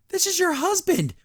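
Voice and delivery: high, pleading complaint; exasperated fan. Real, not acted. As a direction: whine for the kiss the show won't give. Fan complaining about the kiss.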